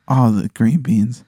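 ordinary talk

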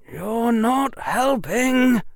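high, slow, measured character voice; high-pitched mild rebuke. A narrator's reading. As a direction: say it slowly with mild reproach. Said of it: "said in a mild tone".

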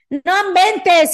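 slow stunned exclamation; surprised exclamation. Real, not acted. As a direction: stretch it out in disbelief. Exclaiming disbelief.

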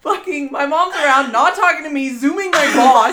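loud, high, laughing disbelief; almost shouting in amazement; telling a shocking dream with a laugh; excited disbelief. Real, not acted.

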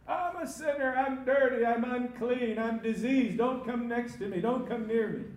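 high, loud warning to keep away; crying out. Acted. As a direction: cry out your shame to passers-by. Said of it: "you crying out".

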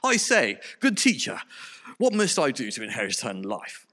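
mock-posh English gentleman; posh accent. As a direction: play a hammed-up posh toff. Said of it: "read this character with rather a posh accent".

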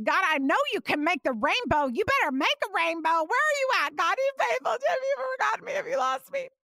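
high, rising desperate cry; crying out to God. Retold or imitated. To God over the missing rainbow, a high tearful cry. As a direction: plead and demand through tears.

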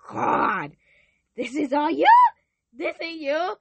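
loud, high, amazed calling out to God; overwhelmed loud amazement; excited disbelief. Retold or imitated. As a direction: cry out in astonished wonder. Reliving first hearing God.

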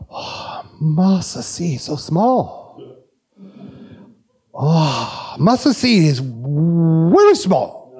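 slow imitated South Korean accent for the crowd. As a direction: marvel slowly in a Korean accent. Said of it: "you like my South Korean accent".

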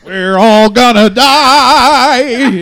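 very slow, high, loud laughing wail; mock doom wail. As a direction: wail it like the end of the world.

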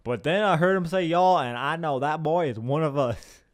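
put-on American Southern accent; mock Southern accent. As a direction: imitate a Southern listener in dialect. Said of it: "to get my Southern audience on board".

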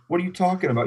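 high, puzzled protest; mock protest. Third base coach protesting to Roy, voiced high. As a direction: play the confused coach. Other coach answers back.

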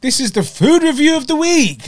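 high announcer voice; show host intro. Acted. Introducing the weekly review to viewers, announcer voice. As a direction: announce it like a TV presenter. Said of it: "man introduced it seamlessly".

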